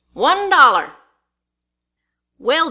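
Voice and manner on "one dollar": firm, higher-pitched bid; calling a bid. A narrator's reading. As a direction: call out your offer, louder. Bid called out at auction.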